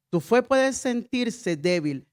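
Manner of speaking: normal talking voice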